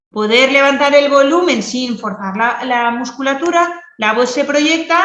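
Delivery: loud and carried, throat kept free; projected loud voice. Shown as a demonstration. Therapist shows the class a loud voice without strain. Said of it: "como estáis viendo".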